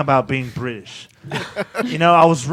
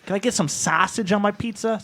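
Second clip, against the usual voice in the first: mock American accent; 8 semitones higher than usual. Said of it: "I developed a great American accent".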